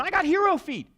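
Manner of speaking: high, loud call for the pass; girl calling. Retold or imitated. Teammate calling for the pass back, high loud call. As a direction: call for the ball proudly. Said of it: "who's going".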